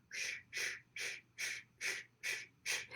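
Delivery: rhythmic unvoiced shushing like blood rushing; heartbeat whoosh. Imitating the mother's heartbeat heard on the ultrasound, rhythmic shush. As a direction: pulse it out in soft whooshes. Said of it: "the mother's heartbeat is going".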